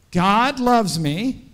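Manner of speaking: slow, high, loud call to the back row; drawn-out rallying shout. Shown as a demonstration. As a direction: project it so the whole room hears. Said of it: "see if we can make them hear you".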